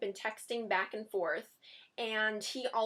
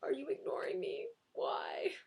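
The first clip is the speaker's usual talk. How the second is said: clingy; 4 semitones higher, 6 dB quieter than usual. Pretend. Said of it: "kind of a clingy question".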